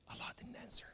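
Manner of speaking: whispered punchline; hushed whisper. Acted. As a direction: breathe it almost silently. Dramatic pause after crying out.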